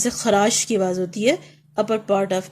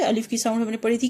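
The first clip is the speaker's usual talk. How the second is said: normal talking voice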